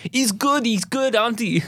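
put-on quick German-accented aside. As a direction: lightly try a German accent. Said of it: "I tried to do a German accent".

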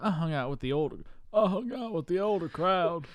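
exaggerated Southern storytelling voice; Bill Clinton Southern accent. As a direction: imitate a movie character's heavy Southern accent. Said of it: "Why are you doing that voice, [name]?".

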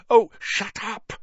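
high, loud frantic snap. A narrator's reading. Harry silencing Ron before they are heard, frantic. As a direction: cut in urgently, high and loud. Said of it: "said Harry frantically".

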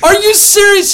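loud, high, stunned cry of disbelief; shocked disbelief. Retold or imitated. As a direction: blurt it out like you can't believe your ears. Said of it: "I was like".

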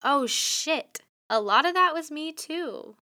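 high surprised inner voice; realizing. Retold or imitated. Host retelling her own realization, high and surprised. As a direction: say it like a sudden realization. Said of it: "be like".

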